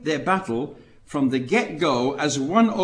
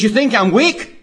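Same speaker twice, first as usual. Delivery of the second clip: defiant challenge; 7 semitones higher, 9 dB louder than usual. Retold or imitated. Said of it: "And he says".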